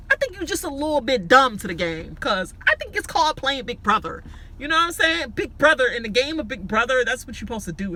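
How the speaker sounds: high, loud hyped-up rant; fired up, preaching the game; excited. Real, not acted.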